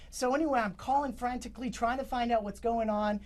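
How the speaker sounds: ordinary talk, nothing marked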